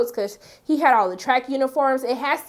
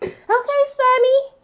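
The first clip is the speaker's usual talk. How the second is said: high, gushing hype for his fresh look; excited squeal; 13 semitones higher than usual. Retold or imitated. Hyping up Sonny's fresh cut, high squeal. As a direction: squeal his name like a fan.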